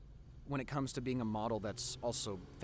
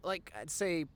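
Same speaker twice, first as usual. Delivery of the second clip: usual speaking voice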